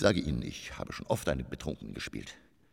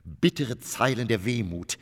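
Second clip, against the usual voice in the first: solemn recitation; 6 semitones higher than usual. Acted. Actor reciting a poem to the empty stage, solemn. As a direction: intone each image gravely. Poetic stage monologue over music.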